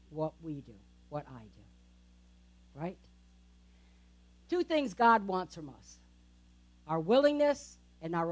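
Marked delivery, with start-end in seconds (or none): 0.1-3.0 hushed. Real, not acted. Sermon point drops to hush.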